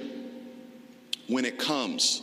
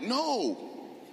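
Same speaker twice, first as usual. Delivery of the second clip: mock dismissal; 10 semitones higher, slower than usual. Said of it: "'cause I'm like".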